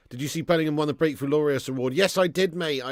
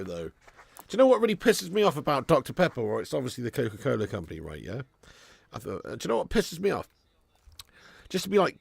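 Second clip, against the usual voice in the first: usual speaking voice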